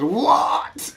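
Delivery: high, loud shocked cry; shocked sister. Retold or imitated. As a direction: mimic the sister's sudden disbelief. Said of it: "And she goes".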